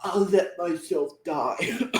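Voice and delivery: strained, slow put-on heroine; Brooklyn. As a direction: imitate a melodramatic self-sacrifice, drawn out. Imitates Brooklyn sacrificing herself.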